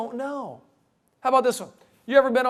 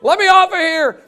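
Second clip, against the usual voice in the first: shrieked much higher and louder; panic-scream like a terrified kid; 11 dB louder, much slower than usual. Retold or imitated. Kids begging to get off the spinning merry-go-round, shrieking. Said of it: "they're screaming".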